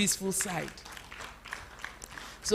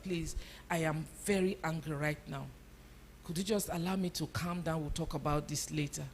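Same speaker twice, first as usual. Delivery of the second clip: softer, lower, calm request; calm and measured; 4 semitones lower, 8 dB quieter than usual. Shown as a demonstration. Parent calmly asking for time to cool down, soft and low. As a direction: settle your voice and ask gently. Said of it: "you're able to voice out".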